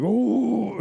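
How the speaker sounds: high drawn-out ooh; held-back scream. Retold or imitated. Telling viewers he holds back, high ooh. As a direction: show the scream he swallows. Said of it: "every single time".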